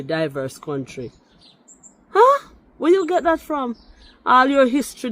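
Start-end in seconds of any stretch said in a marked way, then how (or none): 2.1-5.1 high-pitched, incredulous challenge; real incredulity, pitched way up; indignant disbelief. Real, not acted. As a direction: demand the source in sheer disbelief. Challenging a claim in a rebuttal.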